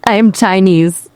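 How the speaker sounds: put-on barely-there copy of a Chinese accent; bad Chinese accent. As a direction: attempt a Chinese accent and hardly manage it. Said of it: "Gosh, that's so bad".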